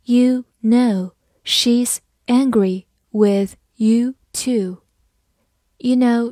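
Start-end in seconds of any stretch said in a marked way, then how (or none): 0.0-4.8 slow, deliberate, word by word; teacher modelling a sentence slowly; slow reading. Shown as a demonstration. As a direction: leave a gap after every word. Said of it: "我们再慢慢来读一遍".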